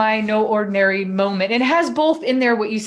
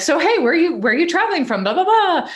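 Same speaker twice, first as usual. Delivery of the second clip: friendly chatty greeting; 9 semitones higher, 4 dB louder than usual. Retold or imitated. Retold friendly chat with stranger.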